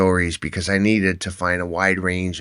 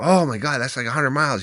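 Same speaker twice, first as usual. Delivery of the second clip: higher, wide-eyed oh my God; amazed stranger; 7 semitones higher, faster than usual. Retold or imitated. Stranger in a hotel line hears of the ride, amazed. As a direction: play the stunned stranger hearing the mileage.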